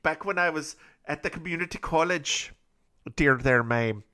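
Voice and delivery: sing-song comic Scottish brogue; rambling, hammed-up Scottish storyteller; put-on Scottish accent. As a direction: tell a tall tale in a mock Scottish accent. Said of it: "with just this accent I have right now".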